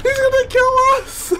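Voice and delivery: high laughing yell to run; laughing scream while fleeing; panicked laughing shout. Real, not acted.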